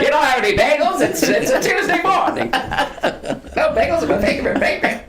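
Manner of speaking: put-on high, laughing old-movie accent; laughing mid-Atlantic caricature; old-timey voice. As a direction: ham up a 1940s film voice through giggles. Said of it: "like a person from this era".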